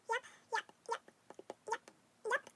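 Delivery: "yep" short and high, P popped. Shown as a demonstration. Reader testing the line, "yep" with popped P. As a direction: repeat "yep" in separate little pops.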